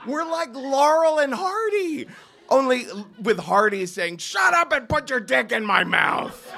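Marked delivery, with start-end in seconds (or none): 4.2-6.5 yelled retort. Retold or imitated. Shoving match in the cab.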